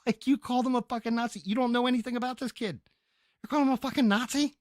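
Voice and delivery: high, loud disbelieving rant with a laugh; incredulous outburst. Real, not acted. Streamer to chat, raging that she called Danny a Nazi, high and loud. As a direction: squeal the outrage at her.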